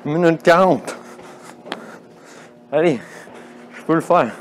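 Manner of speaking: winded, pushing through laps; out of breath. Real, not acted. Running stairs, counting laps, straining.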